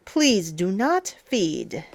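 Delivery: usual speaking voice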